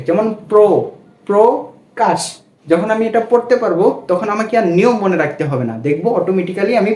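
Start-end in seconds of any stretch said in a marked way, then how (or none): 0.5-2.2 প্র said as "pro" in প্রকাশ; ও-কারান্ত pronunciation. Shown as a demonstration. Teacher explaining the first rule, প্রকাশ said with an o after প্র. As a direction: round the vowel of প্র to an o, pro-kash. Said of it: "এর উচ্চারণটা ওকারান্তে হবে".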